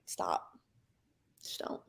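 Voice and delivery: soft, dropping to a whisper; whispered plea. Retold or imitated. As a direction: hush them, begging them to stop. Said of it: "I'm like".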